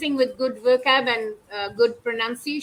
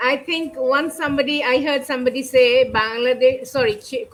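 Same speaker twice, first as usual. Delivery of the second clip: normal talking voice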